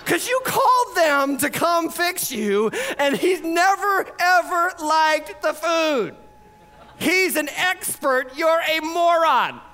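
high, drawn-out exasperated retort. Retold or imitated. Couple scolding TV owners who never watch the show, exasperated. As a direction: spell it out slowly and high, as to a fool.

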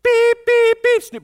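high, loud, piercing beep beep like an alarm clock. Retold or imitated. Preacher imitating God as an alarm clock, high loud beeps. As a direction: mimic an alarm going off, sharp and high. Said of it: "And then it goes".

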